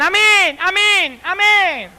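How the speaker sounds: high, loud, sing-song amen; hammed-up churchwomen chanting amen; mindless amen sisters. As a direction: parrot the amen without listening. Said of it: "Tres hermanas que decían".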